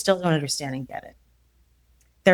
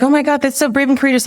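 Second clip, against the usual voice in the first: high, quick mock admiration; higher-pitched, hurried gushing; 7 semitones higher, 3 dB louder, faster than usual. Mimics people praising her move.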